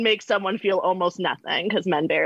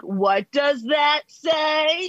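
slow, drawn-out, raised-voice imitation; mimicking leering coworker; 4 semitones higher, much slower than usual. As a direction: stretch each word, mocking the gawker. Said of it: "he would be like".

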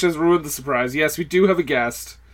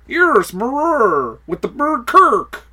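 Ermahgerd voice; 6 semitones higher than usual. Pretend. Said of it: "It's the Irma Gerd Burks girl".

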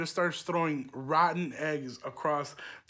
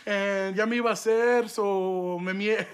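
put-on higher, louder kid's excuse to mom; mock-innocent excuse, pitched up; making excuses; 4 semitones higher, 3 dB louder than usual. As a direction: plead your phony case, switching into Spanish, drawing out the end. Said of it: "I had to cap".

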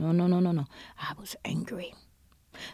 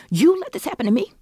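higher and louder, anguished accusation; furious cry; 12 semitones higher, 7 dB louder, faster than usual. Retold or imitated. Grieving mother accusing God, anguished shout. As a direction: cry it out at God, raw anger. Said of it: "I was instantly angry".

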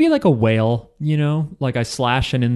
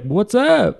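mock whale greeting, pitched way up; cheerful whale; 9 semitones higher than usual. Whale greeting friends across the ocean, high and cheerful. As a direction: call out high like a happy whale. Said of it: "they're like".

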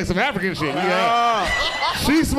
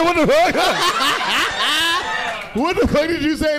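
higher, louder stunned outburst; a hammed-up loud what-the-fuck in disbelief; shocked retold yell; 6 semitones higher, 3 dB louder than usual. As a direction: act out being floored by the kid. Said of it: "I said".

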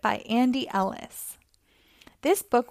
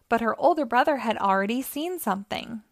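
ordinary delivery, nothing marked

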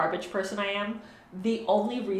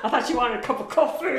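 higher, louder punchline in a put-on voice; Eddie Izzard; 5 semitones higher, 6 dB louder than usual. Fan retelling a comedy bit to the co-host, hammed-up impression. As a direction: imitate a stand-up bit, breaking into laughter. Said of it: "in Eddie Izzard's Dress to Kill".

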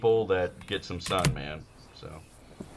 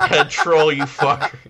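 mock Australian; 6 semitones higher, 6 dB louder, slower than usual. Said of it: "vulgar kangaroo jack".

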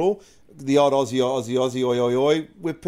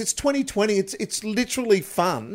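much higher, incredulous pleading; exasperated protest; 9 semitones higher than usual. Retold or imitated. Retelling his reply to the security guard, much higher and exasperated. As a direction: argue back in disbelief. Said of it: "I'm like".

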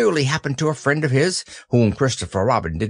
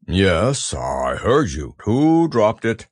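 Eeyore voice; 2 semitones lower, slower than usual. Acted. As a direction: drag it out mournfully. Eeyore speaking.

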